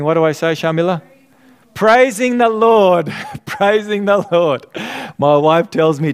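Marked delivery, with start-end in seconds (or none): 1.7-3.1 joyful answer. Shown as a demonstration. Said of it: "You know what I say?". 3.3-4.7 said through a laugh. Shown as a demonstration. Pastor repeats his own answer, laughing. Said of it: "You know what I say?".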